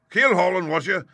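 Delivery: high, loud indignant repeat; scornful echo. A narrator's reading. Landlord seizing on Morgan's answer, scornful. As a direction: pounce on his word scornfully.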